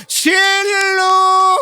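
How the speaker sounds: high, drawn-out sing-song call. Retold or imitated. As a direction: call the wife from downstairs, stretched. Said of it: "મારા મિસિસને બોલાવો". (પત્નીને ઊંચા લંબાવેલા સૂરે બૂમ)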